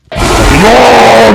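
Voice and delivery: long high frustrated yell; real gamer frustration howl; frustrated wail. Real, not acted. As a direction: let out a raging wail at the game. Raging at the game.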